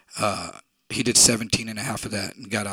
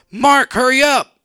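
much higher and louder, slow; frantic shout; 14 semitones higher, 12 dB louder, slower than usual. Retold or imitated. Freddie screaming at Mark to hurry, frantic. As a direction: scream it across the store.

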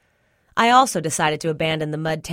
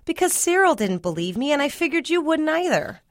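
put-on high-pitched child excusing herself; defensive girl; 10 semitones higher than usual. A narrator's reading. Spud excuses her silence to Mama, high defensive voice. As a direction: explain yourself in a high voice.

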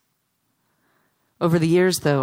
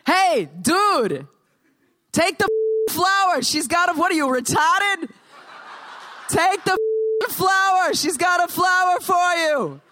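high, loud Boston heckle; Boston accent shout; 3 dB louder than usual. Acted. Sidewalk onlookers shouting after the dude, yelling in Boston accents. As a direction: bellow after someone in a broad Boston accent. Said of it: "this is my best Boston accent".

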